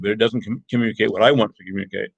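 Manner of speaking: normal talking voice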